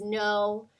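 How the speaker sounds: plain reading voice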